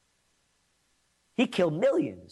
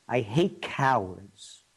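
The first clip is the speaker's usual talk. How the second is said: stern mother; 7 semitones lower, slower than usual. Retold or imitated. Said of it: "my mother would look at me and say".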